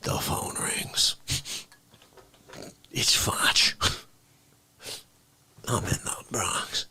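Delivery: hushed whisper. Acted. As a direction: whisper the news. Whispered narration.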